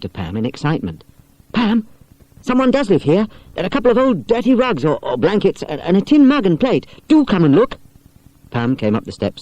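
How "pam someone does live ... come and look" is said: higher, eager schoolgirl; excited girl. Acted. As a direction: urge your friend to come look. Barbara excited in caravan.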